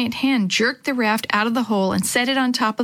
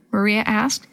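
plain reading voice

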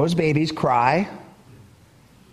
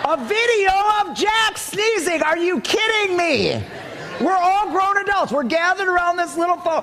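incredulous; 10 semitones higher than usual. Real, not acted. Preacher mocking grown adults round a phone, incredulous. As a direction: marvel in mock disbelief.

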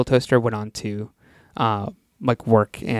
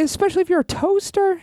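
high squeaky voice. Pretend. Joking high emphasis.

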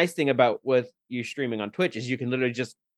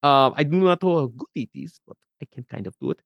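put-on Russian accent; a bit of Russian in the voice; 3 semitones higher than usual. Shown as a demonstration. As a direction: try out a rough Russian accent. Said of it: "do a little bit Russian".

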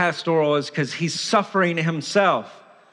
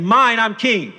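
loud, higher, grabbing demand; 5 semitones higher, 5 dB louder than usual. Acted. Self-crowned heart ordering God to make it happy, demanding. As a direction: demand it forcefully, like a self-made king.

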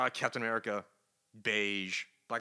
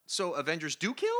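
normal talking voice

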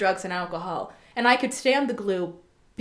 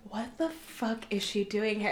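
hushed disbelief; 9 dB quieter than usual. Retold or imitated. Onlookers about her walking back up, hushed disbelief. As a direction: say it slowly under your voice, scandalized. Acting out people's hushed disapproval.

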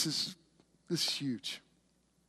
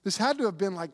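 ordinary talk, nothing marked

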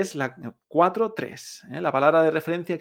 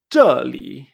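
"zheli" with a loud falling first syllable, then a low curve; dry fall, curved tone; 4 semitones lower, 8 dB louder than usual. Shown as a demonstration. Teacher modelling the word for here, "zheli" falling then curving. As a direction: hit "zhe" dry and dropping, let "li" dip and curve. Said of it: "que es el, el curvo".

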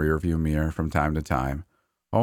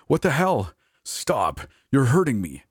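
frightened yell; 8 semitones higher than usual. Acted. Mitchell crying out as Leia attacks him, frightened yell. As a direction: yell in panic as she hurts you. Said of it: "That sounded like Mitchell".

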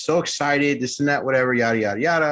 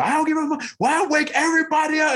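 much higher mock rant of the furious girlfriend; imitating screaming girlfriend. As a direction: squeal her tirade up high, half-joking. Girlfriend yelling at the door.

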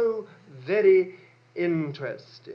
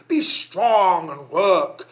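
emphatic charge; 3 semitones lower, 9 dB louder, much slower than usual. Acted. Preacher urging, slow and loud.

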